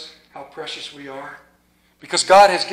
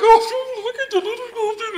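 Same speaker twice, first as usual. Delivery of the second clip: much higher, choked-up coo; cooing grandfather welling up; tearful grandpa. Retold or imitated. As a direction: melt over the baby. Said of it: "Pap's like".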